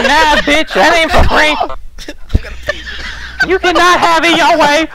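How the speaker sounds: very high-pitched caricature of a woman reminiscing; mock grandmother storyteller. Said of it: "talking to her grandchildren".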